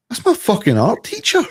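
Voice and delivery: high, incredulous exclamation; astonished outburst. Retold or imitated. Pub-goer suddenly recognises the singer on stage, astonished outburst. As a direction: blurt it out loud in disbelief. Retelling stunned recognition of teacher.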